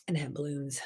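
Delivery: usual speaking voice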